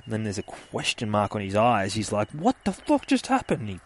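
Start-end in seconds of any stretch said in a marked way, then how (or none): 2.3-3.6 high-pitched bewildered outburst; baffled. Retold or imitated. Monster just bitten by the spider, baffled cry. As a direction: act out baffled shock in a high voice.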